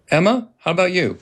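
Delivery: ordinary delivery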